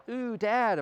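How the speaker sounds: high, loud mock protest; grossed-out kids. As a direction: imitate kids recoiling from Dad. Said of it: "My kids would go".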